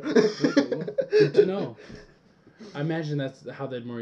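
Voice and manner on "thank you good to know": high giggly mock thanks; laughing. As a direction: laugh through a bright mock thank-you. Mock cheerful reply, laughing.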